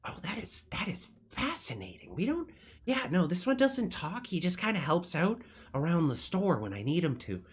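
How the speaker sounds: high, lilting fascinated shopkeeper; enthusiastic shopkeeper. Acted. Shopkeeper praises his handy robot helper, high and delighted. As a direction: start breathy and high, swooping through the praise.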